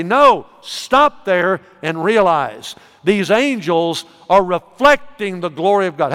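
ordinary talk

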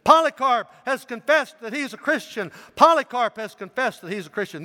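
high, loud public proclamation; crier's announcement. A narrator's reading. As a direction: call it out to the whole arena. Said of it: "announced three times".

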